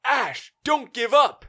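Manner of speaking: high, loud, slow hammed-up pep talk; mock earnest encouragement. Damon cheering on the trapped hero, hammed-up earnest. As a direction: urge him on like a cheesy ally. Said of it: "'cause he says".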